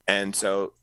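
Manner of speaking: ordinary delivery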